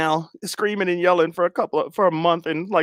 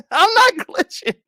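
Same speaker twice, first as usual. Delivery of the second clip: mock high shout; 12 semitones higher, 3 dB louder than usual. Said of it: "She glitching".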